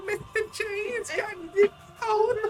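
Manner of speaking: high, far-off clown voice; silly clown. Acted. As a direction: talk like a goofy clown. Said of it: "The clown voice".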